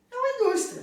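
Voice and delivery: very high, amused little voice; tiny squeaky voice. Shown as a demonstration. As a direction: make the industry sound tiny and laughable. Mimics a tiny little industry.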